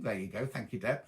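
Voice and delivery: ordinary talk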